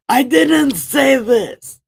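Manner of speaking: indignant God. Retold or imitated. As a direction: boom like an offended God. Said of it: "God's gonna be like".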